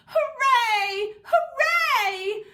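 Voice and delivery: very high, loud shouted hooray; cheering. A narrator's reading. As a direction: cheer at the top of your voice. Dog cheering at dog park.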